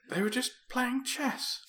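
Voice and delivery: very high, slow put-on voice; mock bewildered protest. Friend defending chess-playing robots, high mock protest. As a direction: draw it out high, close to laughing.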